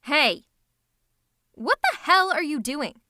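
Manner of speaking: loud, possessive angry shout; angry confrontation. Acted. Villain to attacker hurting the nemesis, angry confrontation. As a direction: shout them away from your nemesis.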